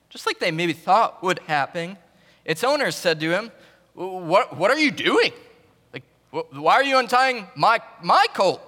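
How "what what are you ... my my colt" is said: a touch of an upset owner, higher and louder; indignant owner. Retold or imitated. Owner catching disciples untying his colt, mock indignant. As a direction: protest mildly over the stolen colt.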